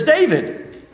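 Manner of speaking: a hint of an irritated older brother; big brother calling out. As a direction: call his name up high, impatient. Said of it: "his older brother comes up and says".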